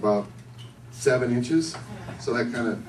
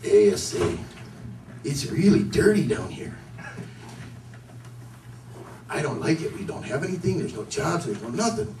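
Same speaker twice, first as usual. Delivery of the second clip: put-on lightly caricatured Mexican accent; 5 dB quieter than usual. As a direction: softly grumble in a mock Mexican accent. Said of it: "all these Mexicans down there going".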